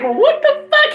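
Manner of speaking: high, astonished outburst; shocked what the fuck. Retold or imitated. Two guests reacting to the party, stunned. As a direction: gape at the situation. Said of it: "We both still like".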